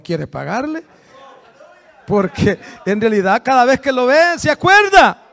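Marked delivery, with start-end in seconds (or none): 2.1-5.2 louder, pressing the point; emphatic preaching. Real, not acted. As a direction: hammer the point home. (recalcando en voz alta)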